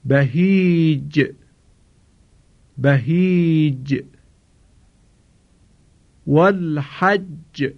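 slow tajwid, the ج of بهیج and والحج bounced; recitation with qalqala. Shown as a demonstration. Teacher models the jim examples for students. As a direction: chant slowly, echoing each jim. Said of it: "مثال برای حرف جیم که دارای قلقله است".

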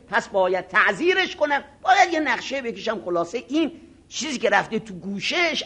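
ordinary talk

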